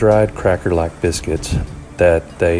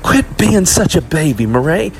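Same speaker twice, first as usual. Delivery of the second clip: put-on high, loud scolding from an older sister; exasperated girl's shout; impatient; 11 semitones higher, 5 dB louder than usual. A narrator's reading. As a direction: snap at her, out of patience. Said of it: "The older Becca lost patience".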